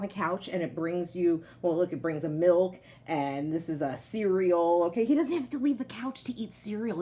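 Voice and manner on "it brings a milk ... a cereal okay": usual speaking voice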